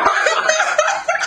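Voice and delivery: squealing burst of laughter; high laugh. Real, not acted. After mimicking Brandon, high laugh at himself. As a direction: crack up at yourself.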